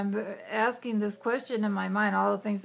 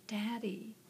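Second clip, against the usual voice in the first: put-on soft small-girl whisper of Daddy; hushed, timid call to her father; child's hushed call; 12 dB quieter than usual. Little girl tugging her dad, hushed call.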